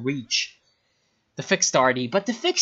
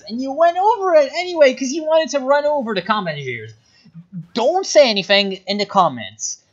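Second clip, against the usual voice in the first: angry rant; 8 semitones higher, 4 dB louder than usual. Real, not acted. Ranting at player's blunder.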